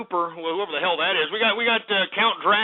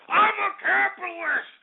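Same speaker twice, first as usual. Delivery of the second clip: screamed declaration; 11 semitones higher than usual. Real, not acted. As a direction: scream it out far higher, stretching it. Repeats declaration as outburst.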